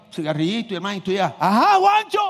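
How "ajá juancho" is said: mock cheerful greeting. Greeting the propped-up dead man.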